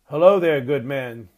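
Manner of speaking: hearty shouted greeting; calling out. A narrator's reading. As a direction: call out to a stranger far off. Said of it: "called Grandfather".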